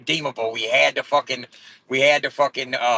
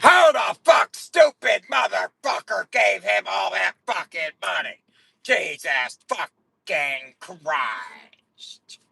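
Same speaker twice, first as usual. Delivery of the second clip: slow, higher mock-outraged shout fading out; mocking haters; 6 semitones higher, much slower than usual. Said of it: "That's what they be doing".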